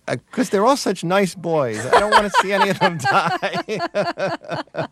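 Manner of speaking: put-on high, sweet old-lady voice through a laugh; doting mother laughing. Son imitating his eighty-year-old mother, high and laughing. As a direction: play his fond old mother, giggling as she pleads. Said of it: "She said".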